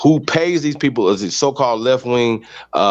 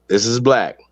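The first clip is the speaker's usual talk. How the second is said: ordinary delivery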